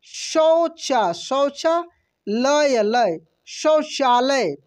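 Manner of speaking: शौचालय read as शौचा then लय, slow and split; broken into parts. Shown as a demonstration. Teacher showing students how to break शौचालय apart. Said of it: "तोड़ कर पढ़ सकते हैं, दो पार्ट में करके".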